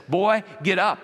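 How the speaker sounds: lightly acted frustrated dad's order; imitating an impatient father; a touch of stern father. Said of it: "he looks at you and says".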